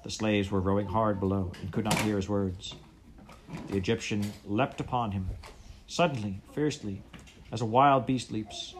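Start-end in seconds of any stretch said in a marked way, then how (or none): none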